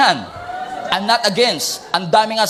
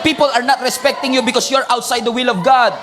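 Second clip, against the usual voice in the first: rising sermon climax. Real, not acted. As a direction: hammer each line harder. Preacher building to climax.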